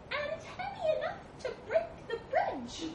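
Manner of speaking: high, hesitant mouse voice; cautious little mouse. Acted. Mouse warning that the bridge will break, high-pitched. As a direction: squeak a careful warning.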